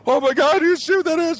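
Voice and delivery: hammed-up terror over a UFO; mock panic. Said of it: "fake panic modes".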